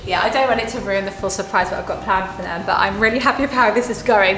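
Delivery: usual speaking voice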